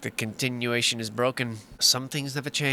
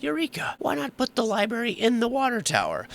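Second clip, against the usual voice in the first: high-pitched mock cry of discovery; mock eureka moment; 9 semitones higher than usual. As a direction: act out a sudden bright idea, pitched way up. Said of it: "he said to himself".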